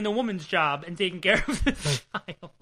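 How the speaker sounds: real laughter breaking into the words; trying not to laugh. Real, not acted. Podcast host mocking the dad doing the woman's job, cracking up. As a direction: barely get it out for laughing. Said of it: "I couldn't say that without laughing".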